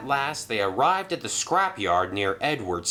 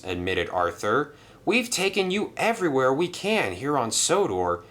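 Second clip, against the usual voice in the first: higher, gentle admission; gentle engine Arthur; 6 semitones higher than usual. Acted. Arthur telling Merlin to go back, higher and gentle. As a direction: break the news kindly.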